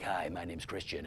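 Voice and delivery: low, soft, self-satisfied slate; smug on-mic slate. Retold or imitated. Actor slating on mic, enamoured with his voice, low and soft. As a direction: croon your name like a polished announcer. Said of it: "and they're like".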